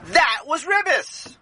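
high, drawn-out accusation; emphatic accusation. Acted. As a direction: stretch the charge out, pitched high. Accusing the seller in quarrel.

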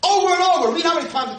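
high, loud preaching cry. Acted. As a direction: hammer the phrase high and loud.

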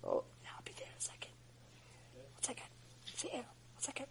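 hurried, barely audible whisper. Acted. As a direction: whisper back so God isn't disturbed. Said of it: "Why are you whispering?".

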